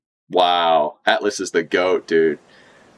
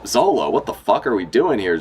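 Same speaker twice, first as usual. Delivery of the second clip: ordinary talk, nothing marked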